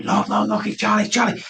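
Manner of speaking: rousing his wife in sudden joy; excited call. Retold or imitated. Waking wife after daughter's message.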